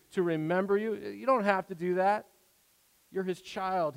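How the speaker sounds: ordinary delivery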